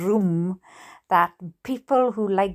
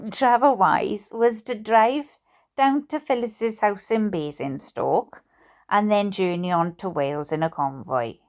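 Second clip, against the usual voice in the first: ordinary delivery, nothing marked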